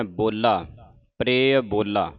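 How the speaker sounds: ਬੋਲਾ with a doubled, held l, bol-la. Shown as a demonstration. Teacher showing the congregation the stressed l. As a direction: lean on the l in ਬੋਲਾ. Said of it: "ਬੋਲਾ ਅਧਿਕ ਲਾ ਕੇ ਬੋਲਣਾ ਹੈ".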